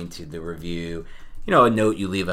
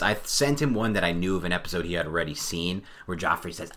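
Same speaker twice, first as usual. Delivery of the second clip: normal talking voice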